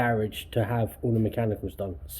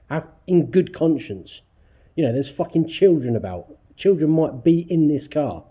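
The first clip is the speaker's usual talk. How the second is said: loud, higher, heated outburst; rising fury at the dodgy repairs, voice raised; venting real anger, louder and higher; 6 semitones higher, 7 dB louder than usual. Real, not acted. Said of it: "just getting more and more pissed off".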